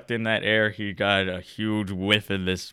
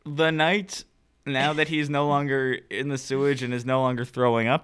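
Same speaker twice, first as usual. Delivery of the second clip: ordinary delivery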